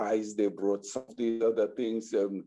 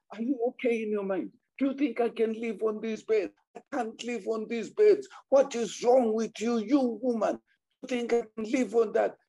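high, fast, incredulous scolding; indignant tirade; 9 semitones higher than usual. Retold or imitated. Husband scolding his wife, fast and high. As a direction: fire questions at her in disbelief.

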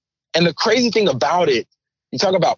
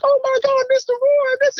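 put-on high-pitched squealing children; excited kids. Kids rush backstage to their chaperone, imitated excited squeal.

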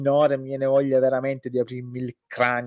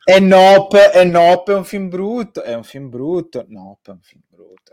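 sarcastic; 5 semitones higher, 11 dB louder than usual. Retold or imitated. Parodying critics of Nope.

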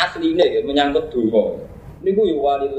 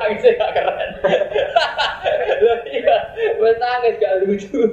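amused, higher-pitched talk through laughter; laughing; 8 semitones higher than usual. Real, not acted. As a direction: laugh as you make the joke. Jokes about uncool weeping. (guyon karo ngguyu)

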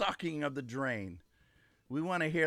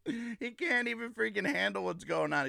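normal talking voice

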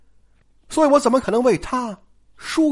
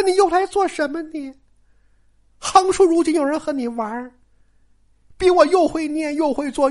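put-on higher, reproachful girl's voice; jealous Daiyu; 8 semitones higher than usual. Daiyu reproaching Baoyu, high and hurt. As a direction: needle him with wounded jealousy. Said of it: "黛玉先开口了，就说".

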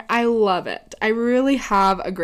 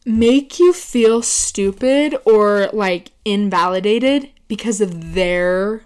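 drawn out, louder, each word leaned on; slow emphatic rant; 5 dB louder, slower than usual. Real, not acted. Ranting to viewers about people who belittle her, slow and emphatic. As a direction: drag the words out to show annoyance.

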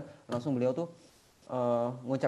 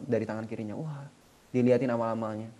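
ordinary talk, nothing marked

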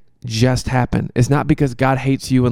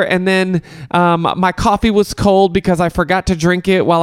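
high-pitched mock first-world whiner; mock complaining; 7 semitones higher than usual. Podcaster making fun of Americans griping over small troubles, mock complaining. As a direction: complain in a high, put-on woe-is-me voice. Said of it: "making fun of people in the United States who are complaining about their trials and tribulations".

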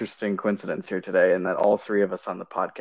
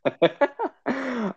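high laugh. Real, not acted. Laughing at the intro.

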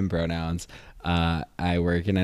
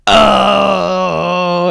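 much higher, loud hammed-up oh of recognition; mock realization; 12 semitones higher, 11 dB louder than usual. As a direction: imitate people suddenly getting the joke. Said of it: "everyone goes".

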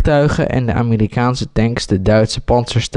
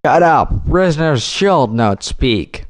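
mild mock yell at the prisoners, slow and raised in pitch; mock shout; 4 semitones higher than usual. A narrator's reading. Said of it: "schreeuwde Rogers".

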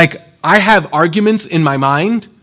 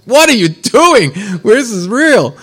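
high, loud, alarmed wife cutting in; shocked spouse, pitched up and loud; incredulous wife; 9 semitones higher, 6 dB louder than usual. Retold or imitated. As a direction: play the wife who can't believe he's laughing. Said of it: "your wife is like".

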